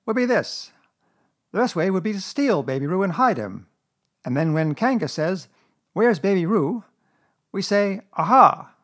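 high, emphatic storybook schemer; Rabbit, bossy planner. Acted. Rabbit explaining the plan to his friends, bossy.

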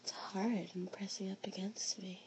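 soft breathy whisper. Acted. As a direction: whisper it close to the ear.

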